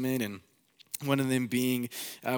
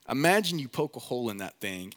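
ordinary talk

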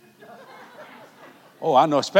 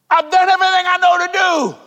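much higher, shouted plea for an answer; loud pleading cry; 11 dB louder than usual. Retold or imitated. Preacher retelling his plea to God, loud desperate shout. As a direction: beg God at full volume.

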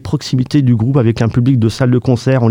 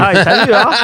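higher, laughing reaction; amused laughing; 6 semitones higher than usual. Real, not acted. Teasing the co-host about the accent, laughing. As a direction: say it through a laugh.

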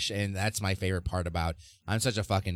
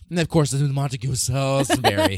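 raised, loud mock-English flourish; put-on, overly English voice; exaggerated English accent; 5 semitones higher, 6 dB louder than usual. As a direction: ham up the Englishness. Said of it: "so fucking English".

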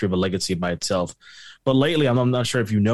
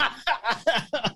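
high laugh; 13 semitones higher, 6 dB quieter than usual. Real, not acted. Laughing at own bad accent.

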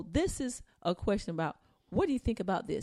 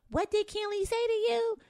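mocking coaxing voice; 11 semitones higher than usual. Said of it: "filming that and saying".

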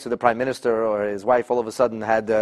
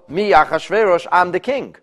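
higher, louder boast of the king; arrogant king; 7 semitones higher, 6 dB louder than usual. Retold or imitated. King asserting power over Vashti, lightly hammed boast. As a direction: puff up and proclaim it. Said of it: "and she'll know".

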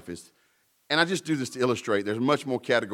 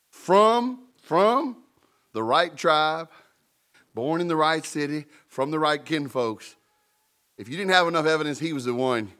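higher and louder, stacking each right; preaching climax; 6 semitones higher, 5 dB louder than usual. Acted. Preacher piling up proof of Jesus, full preaching force. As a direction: build it up like a revival peak.

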